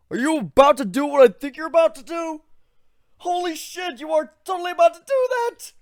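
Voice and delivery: a friend who can't believe what he's about to see; Ivan's voice rising in thrilled disbelief; excited cry. Acted. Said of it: "Ivan cried".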